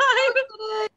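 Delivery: high, excited cry; triumphant. Retold or imitated. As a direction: shout it out, overjoyed.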